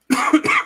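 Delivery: loud hacking cough; harsh cough. Real, not acted. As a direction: cough hard into the mic. Coughing fit on mic.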